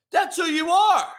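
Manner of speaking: high, heated yell; accusing shout. Real, not acted. Heated rant at chatters.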